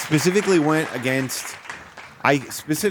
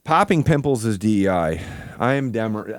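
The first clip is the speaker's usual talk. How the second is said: ordinary delivery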